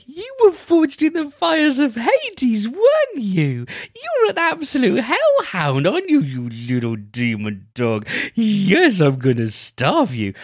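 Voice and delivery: very high, slow, cooing pet voice; sweet talking to a dog. Shown as a demonstration. To the dog, sweet nothings that are insults, high cooing. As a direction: coo the threats like baby talk to a puppy. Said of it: "how you talk to dogs".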